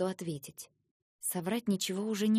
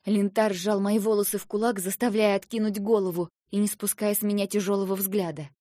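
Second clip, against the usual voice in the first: ordinary delivery, nothing marked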